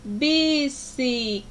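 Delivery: "bicicleta" slowly, in four separate syllables; each syllable of "bicicleta" drawn out alone. Shown as a demonstration. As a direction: break "bicicleta" into bi, ci, cle, ta. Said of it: "abri a minha boca quatro vezes".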